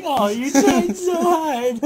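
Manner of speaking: high, slow, syrupy pity for a loser; loud, drawn-out mock sympathy; mock condescending pity. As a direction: coo it down like patting a kid's head. Mocking a winner consoling loser.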